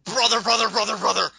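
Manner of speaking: loud, high, rapid-fire repeats; mock wrestler catchphrase. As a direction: echo the catchphrase in a pushed, put-on voice.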